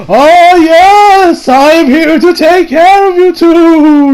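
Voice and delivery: high, loud, drawn-out ghost voice; spooky wizard, stretched out and high; ghostly wizard. Acted. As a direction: wail it slowly like a hammed-up spook. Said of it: "why I'm sounding like a ghost".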